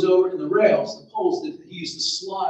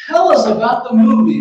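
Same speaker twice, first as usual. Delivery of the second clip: loud, high parental call; voiced mother, pitched up and loud; mother's eager demand; 6 semitones higher, 13 dB louder than usual. A narrator's reading. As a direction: call it out as the waiting mom. Waiting mother questions son, story.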